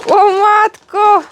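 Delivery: loud, high, drawn-out exclamation; exclaiming in amazement. Real, not acted. Exclaims at dog's presents.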